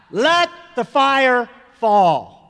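loud, high, drawn-out call; crowd crying out. Retold or imitated. Huge mass of people joining in at the firefall, crying out. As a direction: cry it out like a huge crowd, every word stretched. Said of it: "huge mass of people would cry out".

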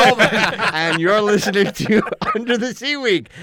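high, loud intro through laughter; can't stop laughing mid-intro. Real, not acted. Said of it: "laughing intro redo".

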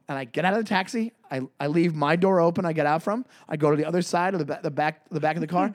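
ordinary talk, nothing marked

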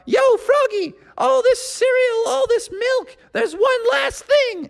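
shouting partygoer. Acted. Partygoer shouting to Froggy about one last thing, high cartoon dude. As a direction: yell it across the party. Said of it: "somebody shouted out".

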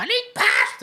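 put-on high, loud, slow child's shriek; child's scream. Wee girl screaming at the drinks cart, loud high shriek. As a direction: shriek it like a bratty kid. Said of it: "this wee girl screaming".

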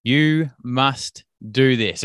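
slow, emphatic command, each word spaced out; calling urge. Retold or imitated. Inner voice pushing the coach to enter, slow and insistent. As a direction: imitate an inner voice insisting, firm and deliberate. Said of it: "it was like calling my name".

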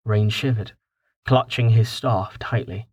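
ordinary delivery

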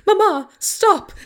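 high, slow hammy plea; pleading wife. Retold or imitated. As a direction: beg in melodramatic distress. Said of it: "Angelica Houston".

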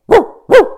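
two loud, high woofs; dog bark. Acted. Joey shows what a dog sounds like, barking. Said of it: "A dog goes".

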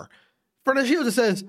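ordinary talk, nothing marked

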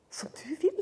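soft, breath-only acceptance; whispered consent. Acted. Mary answering that it be so, whispered consent. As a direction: give in with a whisper. Acting Mary's quiet consent.

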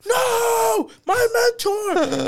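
high, drawn-out mock cry of grief; hammed-up heartbroken shout; anguished Obi-Wan. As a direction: wail it like a melodramatic hero. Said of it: "this emotional, and Obi-Wan's like".

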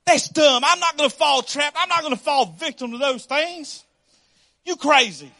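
loud, high, indignant pushback; mock an outraged member who won't hear it; scoffing protest. Acts out a scoffing, protesting church member.

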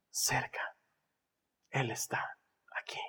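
unvoiced whisper. Shown as a demonstration. Whispering cerca.

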